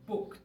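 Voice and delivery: booked said as "bukt", no extra syllable; booked, one syllable. Shown as a demonstration. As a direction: end booked on a short t. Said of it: "que se pronuncia". ("booked" como "bukt", una sílaba)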